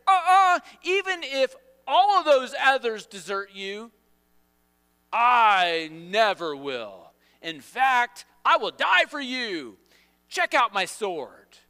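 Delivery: loud, high bravado; hammed-up defiant vow; cocky correcting. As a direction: swear loyalty in big drawn-out boasts. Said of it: "He corrects Jesus".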